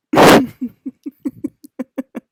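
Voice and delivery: high loud cackling laugh; real laughter; long loud laugh. Real, not acted. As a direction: burst out laughing. Laughing at the memory.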